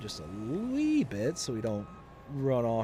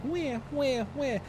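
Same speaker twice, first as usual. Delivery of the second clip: high toddler-style fake wah wah wah; a little girl pretending her doll cries; mock baby crying; 14 semitones higher, slower than usual. Dad imitating his daughter's pretend baby cries, high and childlike. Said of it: "little crying baby sounds".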